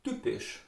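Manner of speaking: typisch with soft, unaspirated T, almost a D; Austrian pronunciation. Shown as a demonstration. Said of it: "In Austria you would say".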